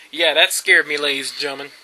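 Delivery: ordinary talk, nothing marked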